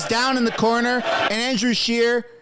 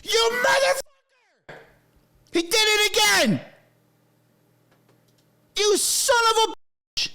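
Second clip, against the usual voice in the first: real angry shouting, much higher; furious yelling; 8 semitones higher than usual. Real, not acted. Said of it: "sorry for yelling, folks".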